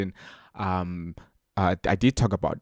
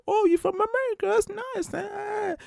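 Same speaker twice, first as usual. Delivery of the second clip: much higher mock voice of an eager local; imitating a delighted local. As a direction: play the welcoming local, high and gushing. Mimicking South African host.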